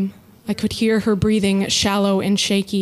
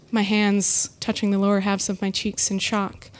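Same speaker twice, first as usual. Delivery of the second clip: usual speaking voice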